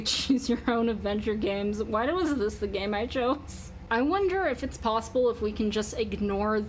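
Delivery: ordinary talk, nothing marked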